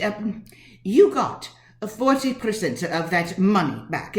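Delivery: ordinary talk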